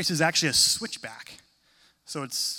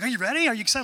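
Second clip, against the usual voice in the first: high and loud eager hype; eager athlete cheering a reluctant runner; pumped-up friend; 8 semitones higher, 6 dB louder, much faster than usual. Retold or imitated. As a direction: fire him up before the race. Triathlete friend pumped before race.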